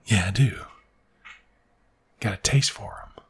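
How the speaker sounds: low, soft confession; low-key admission. Retold or imitated. Boy admitting to his friend he likes worms, low-key. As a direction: own up to it quietly, pitch dropped.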